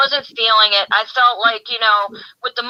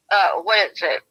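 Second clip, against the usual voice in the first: ordinary talk, nothing marked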